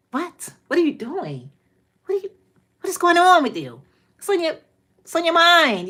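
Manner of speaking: high, baffled mock interrogation; exasperated questioning. Mimicking exasperated parent questioning child.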